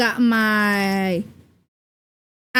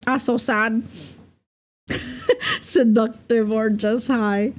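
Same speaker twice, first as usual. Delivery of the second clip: ordinary delivery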